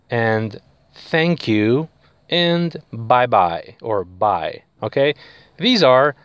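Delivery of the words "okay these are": ordinary delivery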